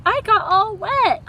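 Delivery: mild complaint. Acted. Mary grumbling to Sydney, a hint of a whine. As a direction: complain about being soaked.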